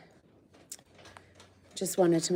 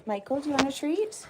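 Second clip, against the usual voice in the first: pet talk; 8 semitones higher, faster than usual. Real, not acted. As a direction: tempt him with a treat in a high voice. Offering pet a treat.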